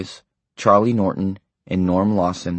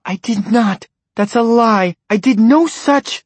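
much higher, louder, slowing denial; shrill denial; 6 dB louder than usual. A narrator's reading. Wife hotly denying her husband's charge, indignant. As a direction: deny it hotly.

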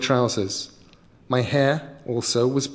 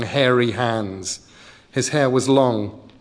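ordinary delivery, nothing marked

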